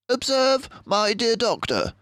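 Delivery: a clever mouse pointing something out; Basil the mouse detective. Acted. Basil points out the cellar to the doctor, high and crisp.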